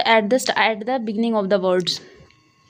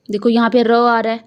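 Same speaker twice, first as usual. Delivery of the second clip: usual speaking voice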